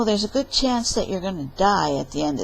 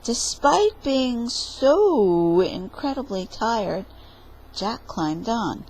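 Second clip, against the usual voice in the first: ordinary talk, nothing marked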